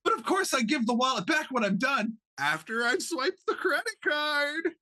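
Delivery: squeaky high gnome voice, shrieking with glee; manic gnome. Acted. Gnome showing off to the space pirate, squeaky and manic. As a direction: pitch way up like a giddy little gnome.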